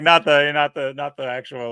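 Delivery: usual speaking voice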